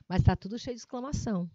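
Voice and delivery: usual speaking voice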